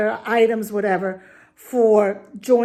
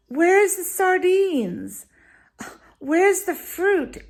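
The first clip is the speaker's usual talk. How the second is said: indignant; 7 semitones higher, slower than usual. Real, not acted. Indignant questions about missing food.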